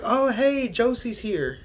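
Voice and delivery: high, bright mock hello; mock cheerful welcome from a viewer; chirpy greeting. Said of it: "They'll be like".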